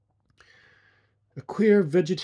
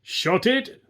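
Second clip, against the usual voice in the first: bellowed; 8 semitones higher than usual. A narrator's reading. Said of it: "the other soldier bellowed".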